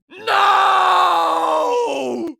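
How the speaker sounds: high, drawn-out melodramatic wail; villain's despairing howl; long anguished scream. Acted. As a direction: scream it and hold the vowel till it fades.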